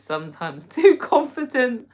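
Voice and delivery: laughing. Real, not acted. Reading viewer's joke.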